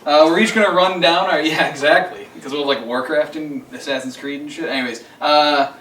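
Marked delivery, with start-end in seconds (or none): none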